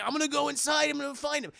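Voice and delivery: hammed-up movie lawman; mock hero. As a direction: declare it with exaggerated resolve. Retold deputy's urgent resolve.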